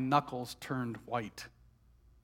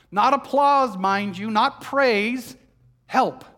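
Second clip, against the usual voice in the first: emphatic insistence; 6 semitones higher, 10 dB louder, slower than usual. Acted. Martha insists she only wanted help.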